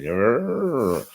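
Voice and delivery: short growl in an odd, altered voice; grumbling growl. Retold or imitated. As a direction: growl back at the insulting dentist. Reacting to teeth insult.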